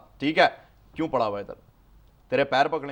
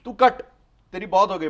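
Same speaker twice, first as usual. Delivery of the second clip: sharp, much higher and louder; angry dismissal; 6 semitones higher, 3 dB louder than usual. Acted. Dr Shah ordering the interviewer out, loud angry dismissal. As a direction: snap him out of the room.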